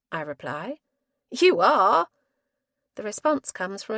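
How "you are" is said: insistent. Acted. Chorus insisting.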